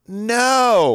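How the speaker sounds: high, loud drawn-out no; exaggerated retold outburst; emphatic mock refusal. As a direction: cry out the refusal for comic effect. Acted refusal to kid's request.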